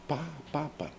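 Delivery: "papa" with the stress on the first PA; stress on the first syllable. Shown as a demonstration. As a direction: break off at "pa-", then say "papa" stressed on PA. ("papa" com a primeira sílaba tônica)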